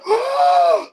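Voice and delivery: high, loud re-enacted gasp; shocked gasp. Reacting to the game-winning shot on the app, shocked. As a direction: blurt out a big gasp of amazement.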